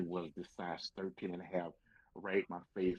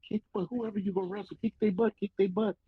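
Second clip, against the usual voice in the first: loud, high-pitched imitated crowd shout; cheering fans; 13 semitones higher, 10 dB louder than usual. Fans urging the rookie on at ringside, excited shouting. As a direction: egg the fighter on like a rowdy fan.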